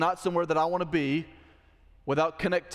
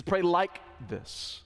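slow, drawn-out "like", then a separate "this"; deliberate, spaced emphasis on "like"; stress on LIKE; 3 semitones higher, much slower than usual. Shown as a demonstration. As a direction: lean long on the word "like". Repeating the stressed correction.

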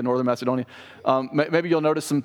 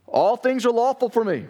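high-pitched, breezy slogan of the crowd; mock Corinthian catchphrase in a raised voice; quoting Corinthians; 8 semitones higher than usual. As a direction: voice the audience's saying, pitched well up. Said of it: "quoting or speaking for his audience".

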